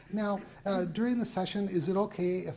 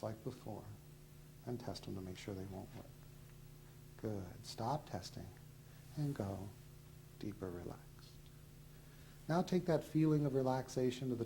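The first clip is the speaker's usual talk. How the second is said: hypnotist induction; 4 semitones lower, 5 dB quieter than usual. Acted. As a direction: lull them down gently and steadily.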